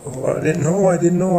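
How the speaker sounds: acted-out alarmed apology; flustered apology. Oscar apologizes for upsetting her.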